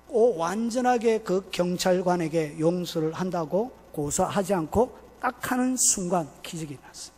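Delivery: ordinary talk, nothing marked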